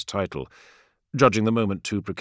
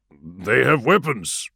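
slow, louder grave warning; 3 semitones higher, 4 dB louder, slower than usual. A narrator's reading. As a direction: warn him gravely. Axata voiced, slow.

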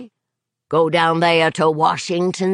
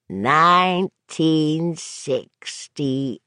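"Nineteen sixty-eight" slow, each syllable separately hit; much slower than usual. Acted. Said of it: "tapping out each syllable for emphasis".

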